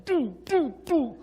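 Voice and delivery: high, loud thud noises; imitated head banging. Boss banging his forehead on his desk, imitated thuds. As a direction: mimic each bang with a loud high beat. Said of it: "He banged his forehead on his desk".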